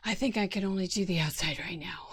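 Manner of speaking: quick, half-joking plea; pleading voice. Pretend. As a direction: beg for it quickly and playfully. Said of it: "That was my pleading voice".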